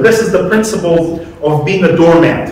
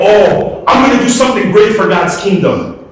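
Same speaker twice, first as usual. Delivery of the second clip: mock boastful eagerness; 5 semitones higher than usual. Preacher mocking a self-important servant, shouting proudly. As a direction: shout it like a proud volunteer showing off. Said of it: "We should not think".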